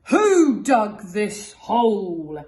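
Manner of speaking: loud, slow, angry bellow; bellowing captain. Acted. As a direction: roar up from the hole. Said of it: "he bellowed".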